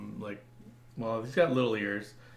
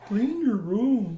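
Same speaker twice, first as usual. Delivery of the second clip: higher, louder, slow mock lecturing; Jordan Peterson impression; 9 semitones higher, 6 dB louder, much slower than usual. Mocking a self-help podcaster, slow lecturing voice. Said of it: "Jordan Peterson's podcast".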